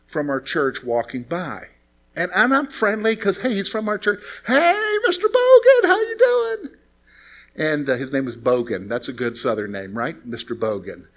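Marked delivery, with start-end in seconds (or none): 4.5-6.7 high, bright calling-out voice; eager young greeting. Retold or imitated. Young man greeting the deacon across the lot, high and eager. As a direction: sing out hello to the old deacon.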